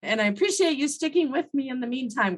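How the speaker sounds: ordinary delivery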